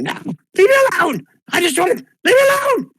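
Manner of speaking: high, squeaky, frantic little voice; a tiny creature shrieking to be left alone; squeaky pixie voice. Acted. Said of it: "a small little squeaky voice".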